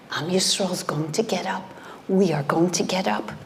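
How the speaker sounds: normal talking voice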